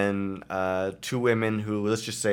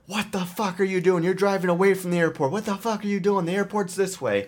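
mock yelling; 9 semitones higher than usual. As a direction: imitate an irate passenger berating the cab driver. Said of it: "they're, like, swearing and yelling at him".

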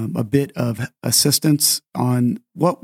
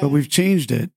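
ordinary talk, nothing marked

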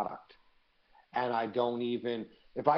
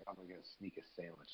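sneaky aside; 5 semitones lower, 18 dB quieter, faster than usual. Retold or imitated. Sneaky plan at Burger King.

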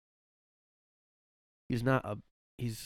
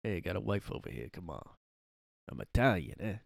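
Sebastian Maniscalco; 3 semitones lower than usual. Acted. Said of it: "'Cause he's Italian".

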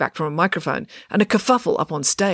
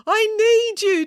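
high, desperate plea; pleading; 4 dB louder, slower than usual. A narrator's reading. Dad begs his hidden children for help, pleading. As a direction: beg them to come forward. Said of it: "Dad pleaded".

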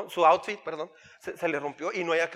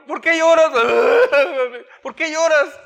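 higher, louder mocking why are you crying with a laugh; mocking parent; 8 semitones higher, 9 dB louder than usual. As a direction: scoff at the crying child, laughing. Parent mocks crying child.

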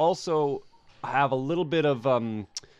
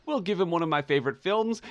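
ordinary delivery

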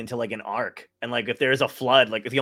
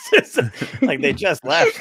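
laughing; 8 semitones higher, 7 dB louder, slower than usual. Real, not acted. Laughing at his own breakup joke.

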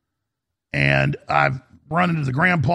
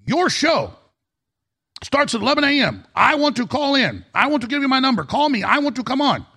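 excited shopkeeper; 10 semitones higher than usual. Retold or imitated. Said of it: "And he says".

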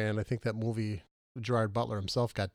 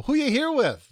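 high, loud mock nosy question; nosy acquaintance; 6 dB louder than usual. Host imitating a nosy acquaintance at the movies, high and loud. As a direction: squeal it like a nosy friend spotting you. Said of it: "you'll go".

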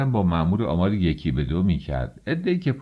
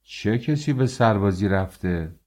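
ordinary delivery

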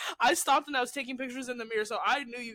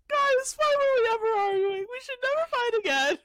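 high-pitched loud excited cry; excited high quote; 14 semitones higher, 5 dB louder than usual. Retold or imitated. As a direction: squeal it out, delighted and loud. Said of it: "we were like".

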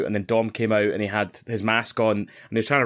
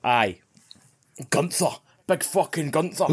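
Scottish accent; slower than usual. Shown as a demonstration. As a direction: play up the Scottish "Gunther". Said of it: "Gunther sounds ridiculous in a Scottish accent".

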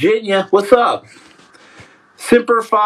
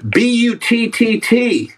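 spelling it out; 3 semitones higher, slower than usual. Pretend. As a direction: spell the letters out slowly. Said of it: "Why are you spelling butt?".

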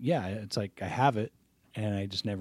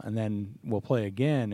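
ordinary talk

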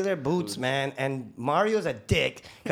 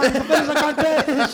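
higher, louder order with a laugh; mock yell; 9 semitones higher, 7 dB louder than usual. Crew ordering rookie to finish concrete, loud mock. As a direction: shout the order half laughing. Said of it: "they're like".

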